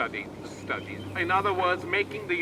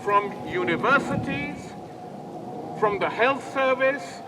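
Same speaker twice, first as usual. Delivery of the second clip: loud, slightly raised, emphatic oratory; rousing rally speech; 4 semitones higher, 7 dB louder than usual. Real, not acted. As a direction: fire up the crowd with forceful demands.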